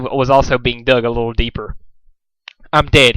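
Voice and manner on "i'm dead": plain reading voice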